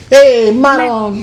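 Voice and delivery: hammed-up Italian catchphrase; Italian-American exclamation. Shown as a demonstration. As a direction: stretch it out big for the camera, Italian-style. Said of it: "Look at the camera and say it".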